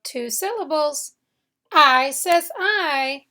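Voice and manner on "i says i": I says I drawn out slowly, both I long; slow, stressed I. Shown as a demonstration. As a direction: stretch each I slowly. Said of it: "at the end of a syllable".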